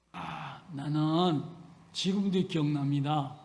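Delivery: usual speaking voice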